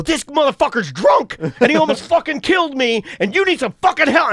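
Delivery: high-pitched, frantic retold rant; losing it. Telling bystanders about the driver, retold losing it. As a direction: re-enact ranting to bystanders, beside yourself. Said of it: "I'm just losing it".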